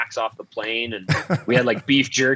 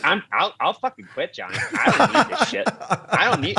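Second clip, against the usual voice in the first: lightly voiced sulking teammate, a bit higher; whiny threat to quit in a higher put-on voice; Phil Kessel voice; 8 semitones higher, faster than usual. Phil griping to Johnny about the Pepsi, higher whiny voice. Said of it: "make sure you do his voice".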